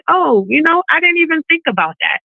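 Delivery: high-pitched acted realisation; mock sheepish excuse. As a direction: imitate someone caught out and excusing themselves. Acting the excuse in reply.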